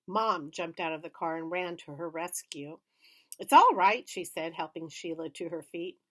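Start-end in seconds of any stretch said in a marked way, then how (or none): 3.4-4.1 reassuring. A narrator's reading. Mom soothing girl.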